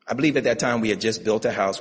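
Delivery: usual speaking voice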